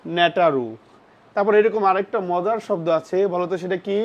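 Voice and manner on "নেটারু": mock-wrong নেটারু, t not ch; wrong pronunciation demo. Shown as a demonstration. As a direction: say নেটারু with a hard t sound, as a learner's mistake. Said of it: "এটার উচ্চারণ করবা".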